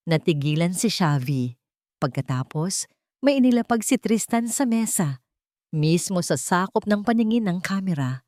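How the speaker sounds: plain reading voice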